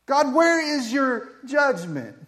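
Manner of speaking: loud, high, slow demand to God; prophet demanding answers. Acted. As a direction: call God out, stretching each word. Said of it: "he says".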